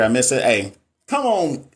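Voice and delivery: ordinary delivery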